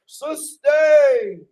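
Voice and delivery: loud, high hammed-up judge's shout; judge calling order. Judge quieting the uproar after an objection, acted loud command. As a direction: shout down an imaginary courtroom uproar. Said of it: "and the judge is like".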